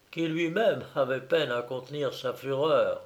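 ordinary delivery

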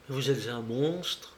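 soft, slow, appalled; breathing out horror at him. A narrator's reading. The countess, horrified, quietly condemns the banker.

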